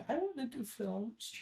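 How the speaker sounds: high, soft put-on voice; eager little voice. Actor retelling saying yes to films, high soft voice. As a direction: imitate yourself piping up, small and high. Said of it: "Exactly in that voice".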